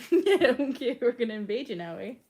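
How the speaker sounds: loud, playful put-on Canadian accent with a laugh; mock Canadian. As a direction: ham up a Canadian invader, ending on eh.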